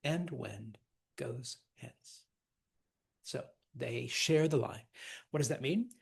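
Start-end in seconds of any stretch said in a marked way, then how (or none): 0.0-2.2 soft, measured beats with pauses; scanning the verse. Shown as a demonstration. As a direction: finish the line softly, word by word. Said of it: "Lady Macbeth finishes up".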